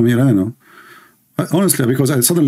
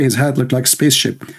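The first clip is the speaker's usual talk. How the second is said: usual speaking voice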